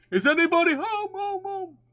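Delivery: calling out. A narrator's reading. Pooh calls into Rabbit's hole, calling out high with fading echo. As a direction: call into the hole, letting it echo away. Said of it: "called out".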